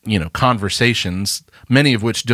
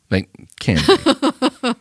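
mock voice; 13 semitones higher, much slower than usual. Mocking sleepy voice back.

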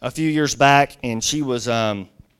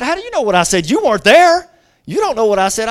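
loud, high teenage protest, acted; indignant retort; 5 semitones higher, 7 dB louder, faster than usual. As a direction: fire back defensively as the girl. Girl challenges him in retold quarrel.

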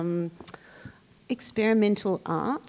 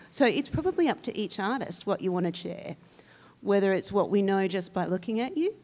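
normal talking voice

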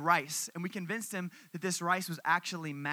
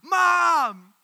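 loud higher tattling shout; shout for mom; 7 semitones higher, 9 dB louder than usual. Retold or imitated. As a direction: yell for mom like a younger sibling. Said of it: "They go straight to mom".

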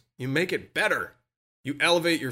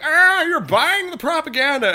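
high, louder sneering critic; mocking critic voice; 12 semitones higher, 6 dB louder than usual. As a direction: scoff like a know-it-all skeptic. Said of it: "people out there who are like".

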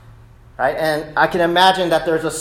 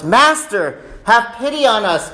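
raised, higher-pitched call; loud voice; 6 semitones higher than usual. Acted. Lepers at a distance begging Jesus, raised pleading call. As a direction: call it out as a plea. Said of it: "and said in a loud voice".

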